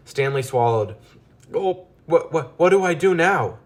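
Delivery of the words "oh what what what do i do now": much higher, frightened pleading; stammering in panic; scared mouse begging for help; panicked. A narrator's reading.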